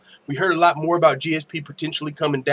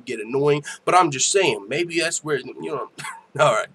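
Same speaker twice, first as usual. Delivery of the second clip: ordinary talk, nothing marked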